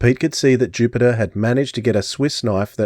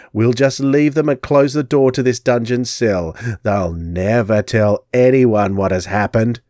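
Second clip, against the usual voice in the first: gloating crone; slower than usual. Acted. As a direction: gloat with false mercy.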